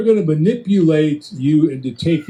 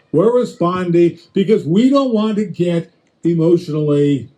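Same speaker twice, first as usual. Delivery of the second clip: ordinary talk, nothing marked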